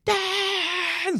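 high, thin mock scream for Dad; daughter screaming. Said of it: "I could hear her actually screaming".

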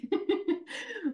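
high, bright laugh cutting into her own quote; real burst of laughter. Real, not acted. Coach laughs while retelling scolding her client, laughing.

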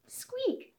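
very high tiny squeak; a quick high mouse squeak. Acted. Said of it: "said the little mouse".

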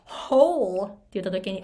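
usual speaking voice

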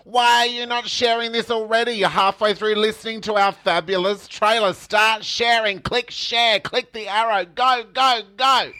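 high, insistent hard sell; mock-bossy urging. Mock-bossy plea to share trailer.

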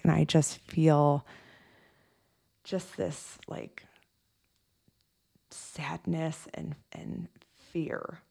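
slow, fading near-whisper; whispered sadness. Retold or imitated. Nurse admitting sadness and fear to her coach, whispered. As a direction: let the words die away in sorrow.

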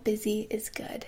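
unvoiced whisper; whispered to self. Real, not acted. Reminding herself that being busy is good, whispered. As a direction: whisper it like a mantra. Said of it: "training my brain to think that".

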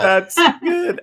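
high, loud laughing reply. Real, not acted. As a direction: burst out laughing on the words. Host cracking up at a joke.

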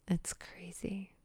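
slow, hushed whisper; awed whisper. Real, not acted. Marveling to viewers at her new life, awed whisper. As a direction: breathe it out in awe.

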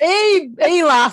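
hammed-up Yorkshire-style hello; broad northern greeting. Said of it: "the more northern I'm gonna get".

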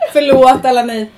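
loud laughing apology. Real, not acted. Co-host to listeners after cracking up, laughing. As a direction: apologise through real laughter. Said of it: "att vi skrattar nu".

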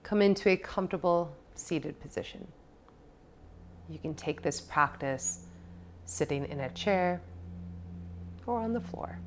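soft, unhurried meditation voice with long pauses; guided meditation. Acted. Podcast host guiding listeners into a seated practice, calm meditation voice. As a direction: settle the listener gently, leaving space between phrases.

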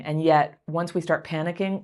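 ordinary talk, nothing marked

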